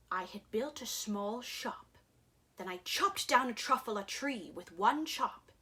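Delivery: ordinary delivery, nothing marked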